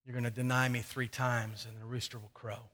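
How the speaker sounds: low, soft and close; quiet confiding warning. Retold or imitated. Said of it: "pulls him a little closer and says".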